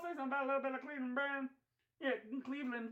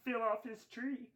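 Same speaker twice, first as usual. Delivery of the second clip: ordinary talk, nothing marked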